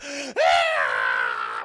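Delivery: long, loud screech; hammed-up shriek of horror; mock freak-out scream. Mina freaking out at the crucifix, loud mock scream.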